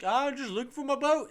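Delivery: high-pitched, badly faked Jamaican accent. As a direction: put on a hammy Jamaican accent. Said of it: "shows up in a really bad Jamaican accent".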